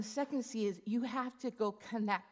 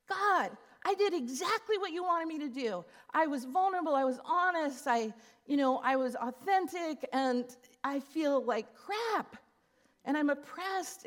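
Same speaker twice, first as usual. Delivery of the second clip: higher, louder frustrated protest; complaining to God; 5 semitones higher, 5 dB louder than usual. Retold or imitated. To God in church, frustrated loud protest. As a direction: vent it up at God. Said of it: "I'm like".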